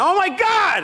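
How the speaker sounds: high-pitched cry of horror; alarmed exclamation. Retold or imitated. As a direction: re-enact the panic on finding the dog. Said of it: "and I went".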